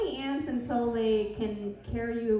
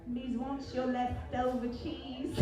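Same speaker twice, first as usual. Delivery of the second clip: put-on British accent; 6 dB quieter, slower than usual. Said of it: "I do a British accent".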